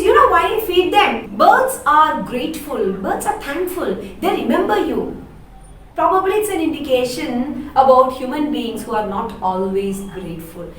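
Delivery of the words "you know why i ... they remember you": Laura, fond of birds. Acted. Laura explaining why she feeds birds.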